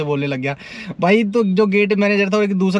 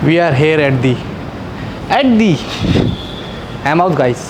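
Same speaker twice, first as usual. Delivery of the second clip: louder, projected over the club music; calling out over noise; 4 dB louder, slower than usual. Real, not acted. Vlogger greets viewers outside the loud club, calling out.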